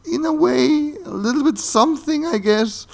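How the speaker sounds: very high, strained hedging; squeaky, comically hesitant concession; reluctant admission. Real, not acted. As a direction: squeeze it out high and grudging. Grudgingly admits liking something.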